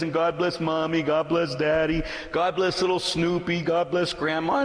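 put-on singsong child's bedtime blessing; little daughter praying. Mother quoting her daughter's prayers, slow singsong. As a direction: imitate a little girl listing her blessings in a slow lilt. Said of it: "she says".

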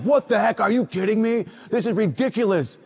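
hammed-up boys griping about cinnamon rolls; mock outrage. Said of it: "they were like".

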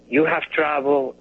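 ordinary talk

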